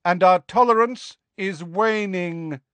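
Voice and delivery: high, projected warning; drawn-up, carrying voice of warning; calling after. A narrator's reading. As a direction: call the threat after them. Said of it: "Magorian called after them".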